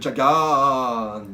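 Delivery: "Jagan" with the final A held very long; drawn-out vowel. Shown as a demonstration. Presenter announcing a series full of A's, drawn-out "Jagan". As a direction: stretch the last A of "Jagan" out and out. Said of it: "avec plein de A".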